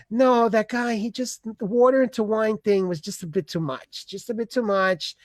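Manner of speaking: usual speaking voice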